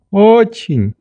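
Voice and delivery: "Очень" high, a little drawn out, as an answer; assuring old doctor. A narrator's reading. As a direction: confirm "Очень" in the old man's voice. Doctor answers Nelly's question.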